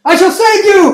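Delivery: very high, very loud hammy hero cry; heroic proclamation. Retold or imitated. Assassin attacking the sister, hammy high heroic shout. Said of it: "He's like".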